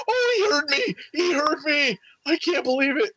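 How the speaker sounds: very high overjoyed squeal; giddy little schoolgirl. Retold or imitated. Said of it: "I was like a little schoolgirl".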